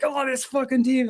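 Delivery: high-pitched mock exasperation; Frank the Tank. Retelling a Mets fan's Cameo rant, mock exasperation. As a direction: imitate a fed-up fan moaning. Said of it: "Frank the Tank being like".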